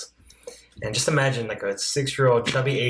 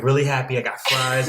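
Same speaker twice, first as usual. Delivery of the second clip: ordinary talk, nothing marked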